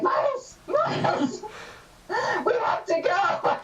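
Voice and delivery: high, laughing frantic yell; frantic shouting. Retold or imitated. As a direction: shout his name in panic. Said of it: "being like".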